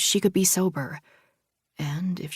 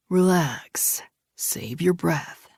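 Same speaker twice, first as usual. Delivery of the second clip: slow menacing man; slower than usual. Acted. Attacker voiced in the story.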